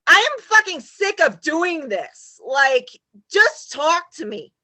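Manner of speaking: fed up, demanding to be heard; hammering each word in frustration; exasperated yell. Retold or imitated. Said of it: "I'm like".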